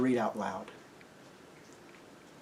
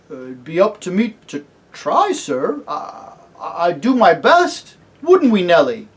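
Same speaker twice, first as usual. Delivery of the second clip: higher, louder, stammering commoner; flustered earnest cabbie; 5 semitones higher, 9 dB louder than usual. Acted. Cabbie promising Aslan, turning to his wife, earnest. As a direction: promise haltingly, then burst out keenly.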